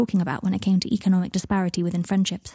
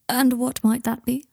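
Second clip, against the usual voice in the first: higher, courteous inquiry; studiedly polite; 5 semitones higher than usual. A narrator's reading. Malfoy resisting a joke about Ron's job, polite. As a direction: ask with careful, lifted politeness. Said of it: "polite as ever".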